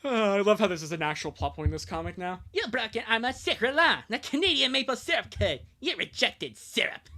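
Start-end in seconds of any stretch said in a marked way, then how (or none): 2.5-7.1 high, loud cartoon Canadian outrage; South Park Canadian. Ranger accusing someone of breaking the maple syrup code, South Park Canadian voice. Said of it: "like a Canadian character from South Park".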